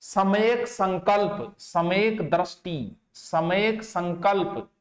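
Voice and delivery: सम्यक संकल्प drawn out slowly, repeated; slow dictation. Shown as a demonstration. Teacher dictating the eightfold path slowly to note-takers. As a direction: dictate each term slowly and clearly. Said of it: "दो तीन बार बोलूं मैं".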